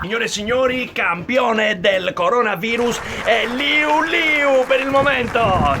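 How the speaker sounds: slow grand announcer voice; showy game show host. Acted. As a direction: crown the champion with pomp. Host crowns champion. (annunciatore solenne)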